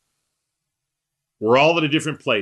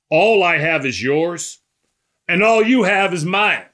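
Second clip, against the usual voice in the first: emphatic proclamation; 5 semitones higher, 5 dB louder than usual. Acted. Preacher quoting Jesus's staggering claim, emphatic and loud. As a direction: proclaim it boldly, pushing the second half higher. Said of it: "And Jesus says it".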